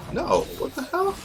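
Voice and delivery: high-pitched, drawn-out mock disbelief; incredulous protest. Protesting at chicks who holler at the homie, incredulous. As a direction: squeal it slowly in comic outrage.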